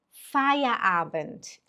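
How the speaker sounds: Feierabend drawn out very slowly; slow model word. Shown as a demonstration. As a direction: stretch every syllable of Feierabend. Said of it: "A frase número um seria". (Feierabend bem arrastado)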